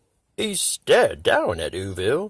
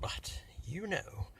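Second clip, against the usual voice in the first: hushed aside; 4 semitones higher, 16 dB quieter than usual. A narrator's reading.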